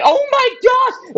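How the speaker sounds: very high, drawn-out mock crowd cry; squealed, slow-motion amazement; imitated shock. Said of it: "everyone was shocked".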